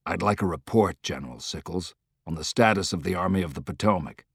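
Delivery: a hint of a weary commander, held back; lightly voiced tired general, low and soft; lightly voiced Grant.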